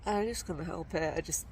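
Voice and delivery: ordinary delivery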